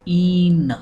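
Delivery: ईन with a long drawn ee; long ee in een. Shown as a demonstration. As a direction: say ईन stretched, long ee. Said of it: "इन भी नहीं है, ऐन भी नहीं है". (ईन लंबी ई के साथ)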